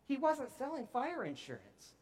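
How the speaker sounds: ordinary delivery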